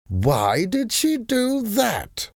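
a lazy, puzzled drawl; Sir Percy's voice. A narrator's reading. Sir Percy asking his wife about the Comtesse, slow and high.

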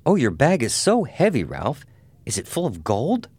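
high-pitched, lightly voiced teasing woman; playful teasing. A narrator's reading. Annabelle teases her fiancé about his suitcase, playful and high.

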